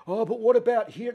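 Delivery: high mocking voice; mock whiny objector. Mocking the critics who say help here first. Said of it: "quoted objector".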